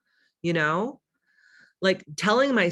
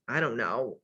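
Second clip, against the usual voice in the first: lower, mumbling mock voice; low mock shrug; 5 semitones lower, 4 dB quieter than usual.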